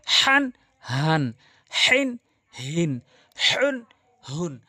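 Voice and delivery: হান হিন হুন drawn out slowly from the throat; Quranic letter drill. Shown as a demonstration. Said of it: "দেখুন পার্থক্য কত রয়েছে".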